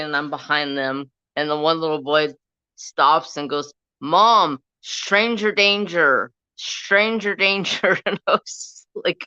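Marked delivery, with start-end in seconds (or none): 4.0-8.0 slow, sing-song stranger danger chant; mock kid warning. Little boy warning his mom about a stranger, slow sing-song. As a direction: mimic a little boy's warning, drawn out.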